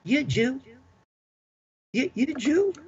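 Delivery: a soldier in a mild Southern drawl, asking in surprise; Southern accent. Retold or imitated. Army buddy from the South asks him, in a Southern accent. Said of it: "he says in a Southern accent".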